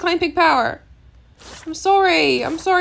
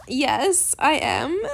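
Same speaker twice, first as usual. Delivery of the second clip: ordinary talk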